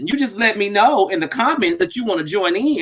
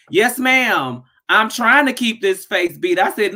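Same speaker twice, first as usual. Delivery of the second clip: usual speaking voice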